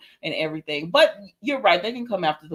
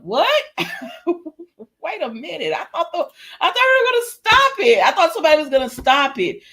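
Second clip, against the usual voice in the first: high-pitched incredulous outburst, building louder; retold disbelief; 9 semitones higher, 4 dB louder than usual. Viewer reacts as they get married unstopped, incredulous protest. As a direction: protest in shrieky, laughing disbelief. Acts own shock at the wedding.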